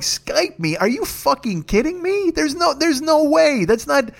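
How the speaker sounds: high, slow, disbelieving whine; petulant outrage. Retold or imitated. Complaining to Gio about a Skype setup, petulant and high. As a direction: drag it out like a sulking kid who can't believe it. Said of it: "why I'm being so petulant about it".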